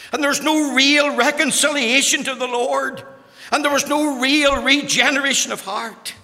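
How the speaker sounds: loud, high, insistent pulpit cadence; fervent preacher building by repetition; preaching at full force. Acted. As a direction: pound out each warning to the congregation.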